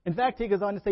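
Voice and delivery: ordinary talk, nothing marked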